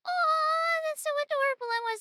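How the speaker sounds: high-pitched delighted squeal. Real, not acted. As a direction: squeal over something cute. Very high, louder.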